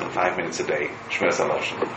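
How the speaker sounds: "five minutes a day" let fall gently. Shown as a demonstration. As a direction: drop to a soft, low "five minutes a day". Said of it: "You can do five minutes a day".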